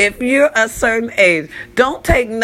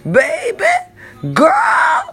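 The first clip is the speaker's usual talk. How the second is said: high squealing laugh-cry; 4 semitones higher than usual. Real, not acted. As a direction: squeal through laughing tears. Laughing cry about choking.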